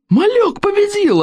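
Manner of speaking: very high, slow triumphant yell; shouting crowd. Acted. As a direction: scream it in wild triumph. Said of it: "орали викинги".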